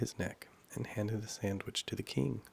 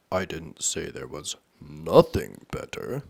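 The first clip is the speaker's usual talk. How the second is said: loud, slow, pointed king; king correcting; 3 semitones lower, 10 dB louder, slower than usual. A narrator's reading. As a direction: correct her slowly and firmly as the king.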